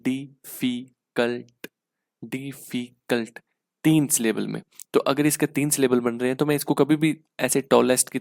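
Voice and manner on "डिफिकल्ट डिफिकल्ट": डिफिकल्ट said slowly in three separate syllables; showing students the three syllables of डिफिकल्ट. Shown as a demonstration. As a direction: break डिफिकल्ट into di-fi-cult. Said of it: "तो मैं कैसे बोल रहा हूँ".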